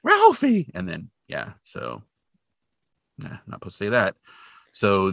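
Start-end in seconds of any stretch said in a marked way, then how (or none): 0.0-0.7 high, loud horrified cry of the name; hammed-up shocked mother calling out; mock shriek of a name. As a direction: scold the boy in a shrieking wail. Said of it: "Just, like, shrieking".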